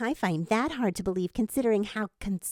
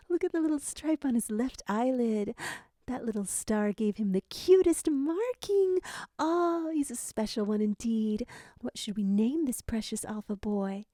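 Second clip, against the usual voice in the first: motherly coo; 2 semitones lower than usual. A narrator's reading. Mother cooing at newborn.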